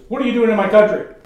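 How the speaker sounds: loud, high, quick retold demand; unhappy challenge. Unhappy local confronting John on the bus, hostile demand. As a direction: confront a stranger with a sharp challenge. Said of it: "He is not a happy man".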